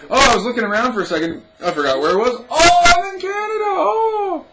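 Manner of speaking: high, loud, fast fake realisation; exaggerated sarcastic amazement; mock surprise. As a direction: ham up a gasp of discovery. Mocks forgetting she's in Canada.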